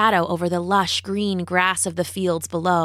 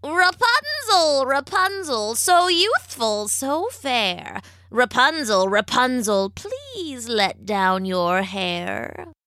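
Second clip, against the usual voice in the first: high, drawn-out witch singsong; witch calling up; 4 semitones higher, slower than usual. A narrator's reading. Witch hails her captive at the tower, calling up in a drawn-out voice. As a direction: stretch out the rhyme like an old witch hailing a tower. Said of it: "the witch would ride up to the tower on her silver horse and call up".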